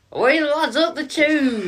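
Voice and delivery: high, loud mock Geordie accent. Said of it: "How are you supposed to do it?".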